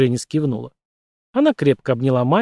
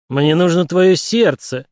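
slow, louder, higher menacing threat; mock evil villain; 5 semitones higher, 5 dB louder, slower than usual. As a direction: drag it out like a horror-movie monster. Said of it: "дрожащим, зловещим голосом".

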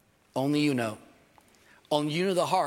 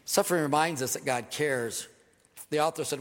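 ordinary delivery

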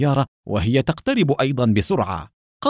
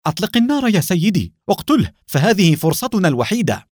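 high-pitched, insistent cry; urgent shout; 7 semitones higher than usual. A narrator's reading. Agent urging her superior to shoot the escapee, high urgent shout. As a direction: urge him to fire right now. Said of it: "صاحت منى".

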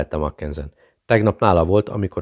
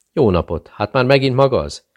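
higher, louder, bright delighted hello; beaming greeting; 7 semitones higher, 5 dB louder than usual. A narrator's reading. Maid happily greets Miller at the door, beaming and higher. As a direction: greet him like a welcome surprise. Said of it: "üdvözölte sugárzó mosollyal".

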